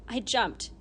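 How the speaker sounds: ordinary delivery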